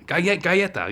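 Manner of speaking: high, excited "galleta" after the whisper; got it. Retold or imitated. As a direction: pounce on the word you just found. Triumphant after figuring it out.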